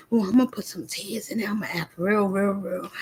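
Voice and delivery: whispered, mock-scheming aside; mocking fake crier. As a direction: imitate someone planning fake tears. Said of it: "because you could cry".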